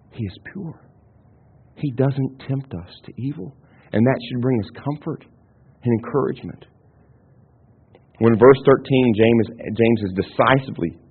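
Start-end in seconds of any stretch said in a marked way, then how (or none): 0.1-6.7 soft reassurance. Real, not acted. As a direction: drop almost to a hush to comfort the listeners. Comforting point after quote.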